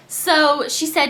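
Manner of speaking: ordinary talk, nothing marked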